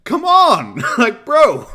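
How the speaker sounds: loud, high-pitched outburst; exasperated shout. Real, not acted. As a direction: yell it in laughing disbelief.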